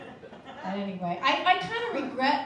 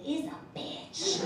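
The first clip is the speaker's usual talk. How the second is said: whispered insult; 4 semitones higher, 5 dB quieter, slower than usual. Retold or imitated. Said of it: "she was like".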